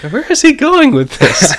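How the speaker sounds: high, squeaky put-on voice; mock puzzlement. Co-host voices the blank stare at host, mock puzzlement. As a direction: pitch it way up in pretend confusion.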